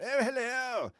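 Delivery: high, airy posh greeting; a lightly voiced poshest officer, far up high; very posh hello. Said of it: "Stephen Fry time ten".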